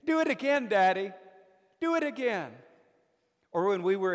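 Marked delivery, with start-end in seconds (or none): 0.0-2.5 put-on much higher, bright child's plea; a small daughter begging her dad for another throw; little girl's delight. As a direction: squeal it like a thrilled little daughter. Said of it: "And she would say".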